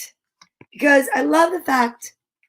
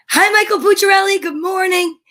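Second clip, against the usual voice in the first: excited greeting; 6 semitones higher, 5 dB louder than usual. Real, not acted. Greeting baby brightly.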